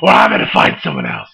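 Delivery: deep gravelly Batman growl; low, growling caped crusader. Acted. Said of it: "The voice is only getting started".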